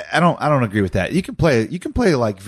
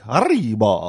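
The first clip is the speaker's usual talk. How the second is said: mock Spanish; slower than usual. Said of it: "my alter ego might be like a Spanish man".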